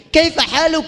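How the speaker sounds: ordinary talk, nothing marked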